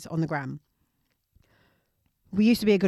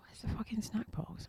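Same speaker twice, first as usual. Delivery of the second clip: whisper; 2 semitones higher, 17 dB quieter than usual. Retold or imitated. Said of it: "I'm like".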